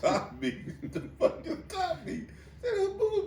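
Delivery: cracking up while asking, voice jumping high; words squeezed out through laughter; laughing. Real, not acted. Retelling funny remark, laughing.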